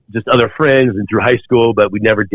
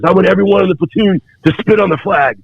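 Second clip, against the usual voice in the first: higher, harsh commanding bark; drill instructor command; 10 semitones higher than usual. Retold or imitated. Drill instructor orders the platoon to spit, barked command.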